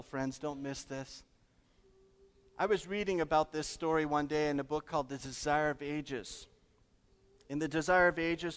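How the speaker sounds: ordinary delivery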